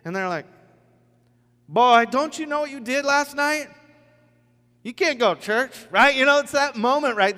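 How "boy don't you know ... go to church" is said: high, loud accusing challenge; mock scolding. Old friends challenging a new churchgoer, mock scolding. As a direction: call him out in a raised, scolding voice.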